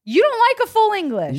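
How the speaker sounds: high-pitched disbelief; high incredulous question. Real, not acted. Host challenges co-host about a full English, high and incredulous. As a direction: ask it high, as if scandalized. Shocked at dislike of English breakfast.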